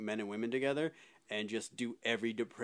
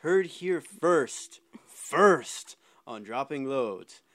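segment announcer; 4 dB louder, slower than usual. Acted. Host debuts his new segment to the co-hosts, slow announcer voice. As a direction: roll out the title like a TV promo.

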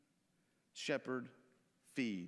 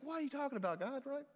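high-pitched, hurt disbelief; grieved; 8 semitones higher, faster than usual. Acted. Peter, asked a third time by Jesus, grieved. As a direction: protest the question, voice pitched up. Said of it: "And Peter was grieved".

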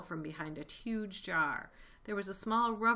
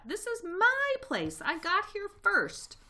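very high, drawn-out shriek; 8 dB louder, slower than usual. A narrator's reading. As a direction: shriek your claim to the place, slowly. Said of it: "shrieked Rat".

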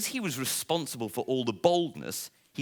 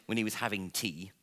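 plain reading voice

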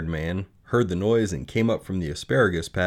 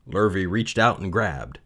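ordinary delivery, nothing marked

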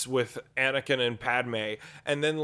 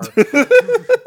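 loud rock yell; 14 dB louder than usual. Retold or imitated. Guitar windmill noise.

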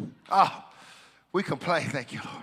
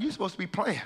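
ordinary talk, nothing marked

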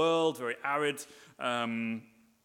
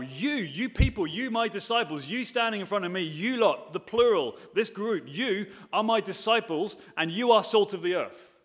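every "you" lifted higher and pressed; emphatic you; 5 semitones higher than usual. Shown as a demonstration. Preacher to congregation, stressing "you" over and over. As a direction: hammer each "you" to point at the disciples. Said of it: "it's emphatic in the original Greek".

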